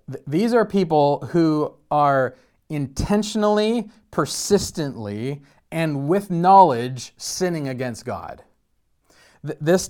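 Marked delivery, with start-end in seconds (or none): 0.1-8.4 each word slowed and weighted, Th-, these are people who are intentionally leaned on; slow emphatic list. Acted. Preacher warning the congregation about deliberate sin, slow and emphatic. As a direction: spell out intentionally, persistently, sinning against God.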